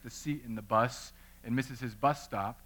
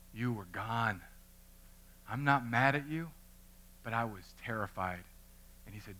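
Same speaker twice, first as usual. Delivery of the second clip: tender to son; 5 semitones lower, 9 dB quieter than usual. Retold or imitated. Dad comforts son after scare.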